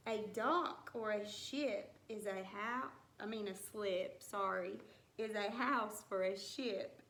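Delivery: normal talking voice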